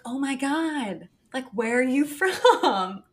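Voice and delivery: high, drawn-out imitation of a gushing friend; mock gushing. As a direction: stretch it slowly and loudly, breaking into a laugh. Friend's gushing wing-woman question, retold.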